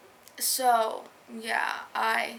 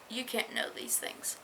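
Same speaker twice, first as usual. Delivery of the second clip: mom's hushed warning in a whisper; soft whispered quote of mom; 6 dB quieter than usual. Retold or imitated. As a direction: whisper it like a secret rule. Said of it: "her mom was like".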